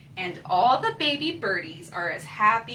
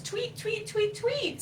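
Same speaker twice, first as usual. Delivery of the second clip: high soft chirping; baby bird tweet; 9 semitones higher, 4 dB quieter than usual. Acted. Baby bird number four wondering where mommy is, high chirps. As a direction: peep like a chick. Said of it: "said baby bird number four".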